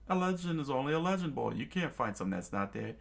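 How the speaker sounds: fast scoffing. Acted. Chef scoffs.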